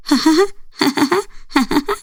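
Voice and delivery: high, thin machine-like laugh; a robot showing off how it laughs; high robotic laugh. Acted. Robot bride demonstrating her laugh to Hamada, high mechanical laugh. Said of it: "أنا أضحك هكذا".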